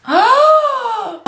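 loud, high gasp of pain; sharp gasp. Real, not acted. Latex tearing at her eyebrows, a high sharp gasp. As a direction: suck in air at the sting.

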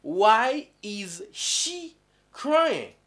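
why is she crying, slow and drawn out; teacher modelling slowly; slow model question. Shown as a demonstration. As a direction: stretch it for the class. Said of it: "So za mwa mwa".

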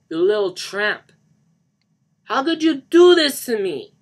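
high, loud enraged scream; furious scream. Acted. Johnny confronts Lisa over the tape, screaming in fury. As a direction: scream the betrayal at full volume. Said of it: "he screamed".

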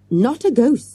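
grimly emphatic. A narrator's reading. Boy correcting his friends about the ghost, grim. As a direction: correct them with grim certainty. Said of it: "said Morgan grimly".

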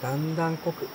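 usual speaking voice

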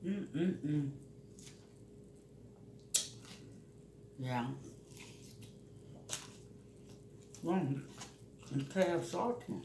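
chewing; humming while chewing; mouth full. Real, not acted. Seen 0: fork of meat, mouth closed and working while humming.

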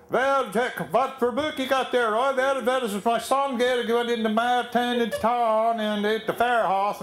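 Pennsylvania Dutch chatter. Retold or imitated. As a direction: rattle it off in thick Pennsylvania Dutch between crew members. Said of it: "they talk funny and they shout".

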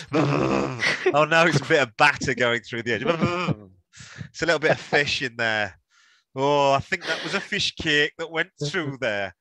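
lightly put-on northeast English accent, mock dismay; a hint of a Geordie voice watching batter go through. Chatting about chip oil in a light northeast accent. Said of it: "my northeast accent".

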